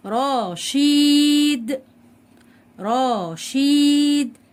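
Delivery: رشيد very slow and loud, "ra-sheeeed"; رشيد stretched in two syllables for the class; drawn-out long vowel. Shown as a demonstration. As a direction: hold the "ee" in رشيد on a high steady note. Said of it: "الشين على حركه الكسر".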